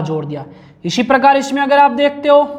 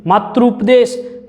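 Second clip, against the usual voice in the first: slow, drawn-out demo; much slower than usual. Shown as a demonstration. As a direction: stretch "मातृपदेश," out slowly, syllable by syllable. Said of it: "मैं दो से तीन बार बोलता हूँ". (धीरे-धीरे खींचकर बोला गया "मातृपदेश")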